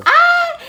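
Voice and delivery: high shriek of laughter; laughing shriek. Real, not acted. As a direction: squeal out a laugh. Burst of laughter after joke.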